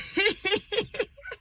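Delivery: bright high burst of laughter. Real, not acted. Laughing at herself.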